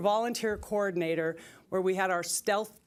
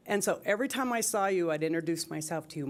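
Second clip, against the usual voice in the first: normal talking voice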